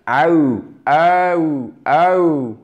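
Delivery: aou gliding from a strong first sound into a lighter ou; drawn-out double sound in aou; diphthong demo. Shown as a demonstration. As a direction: slide aou slowly towards OU. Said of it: "Le deuxième son étant OU".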